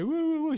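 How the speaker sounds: very high, loud repeated "wou-wou" whoop; mock war whoop. Joker passing colleagues in the corridor, mock whooping. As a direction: imitate a cartoon war cry, high and loud. Same imitated whoop, retold.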